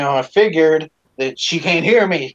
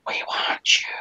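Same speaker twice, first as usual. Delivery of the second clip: a breathy ghostly whisper; creepy whisper; 6 dB quieter than usual. Retold or imitated. Voice in the dark hallway taunting the boy, whispered. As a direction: whisper it like something hiding in the dark. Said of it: "The next thing I hear".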